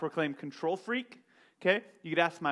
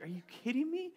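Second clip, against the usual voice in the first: mock disbelief; 9 semitones higher, 6 dB quieter, faster than usual. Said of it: "I'm like".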